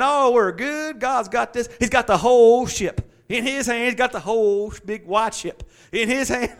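sing-song it like nothing's wrong; carefree confidence. Retold or imitated. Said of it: "The good part should have been".

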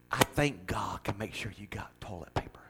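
whispered punchline about toilet paper; hushed whisper, joking aside. Real, not acted. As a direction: whisper the joke to the congregation.

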